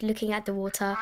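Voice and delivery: usual speaking voice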